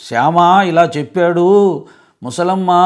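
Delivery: slow, loud telling over noise. Shown as a demonstration. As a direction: raise your voice above the din. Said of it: "గట్టిగా గొంతు పెంచి చెప్పాల్సి వస్తుంది".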